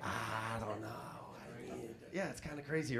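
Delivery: hesitant mumble. Retold or imitated. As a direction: mumble it, trying to dodge.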